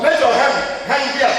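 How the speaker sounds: commanding. Acted. Preacher commands the enemy's hand to hang, loud and high. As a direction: order it like a man of God.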